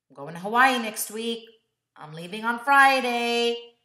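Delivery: loud, upbeat announcement; announcing trip. Retold or imitated. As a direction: declare it proudly to the parents.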